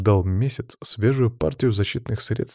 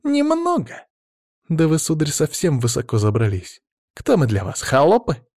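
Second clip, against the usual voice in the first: Немного? shot up very high, outraged; mock his arrogance, flushed; flaring indignant cry; indignant exclamation; 7 semitones higher than usual. A narrator's reading. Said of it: "воскликнула покрасневшая Софья".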